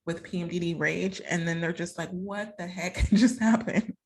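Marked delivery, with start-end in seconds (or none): 2.1-3.9 loud, slow, laughing disbelief; bewildered partner, laughing it out; baffled. Retold or imitated. Partner baffled after PMDD outburst.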